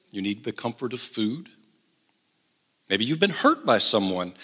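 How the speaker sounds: ordinary talk, nothing marked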